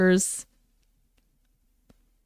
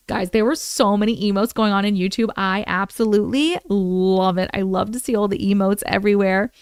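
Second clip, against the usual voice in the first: ordinary delivery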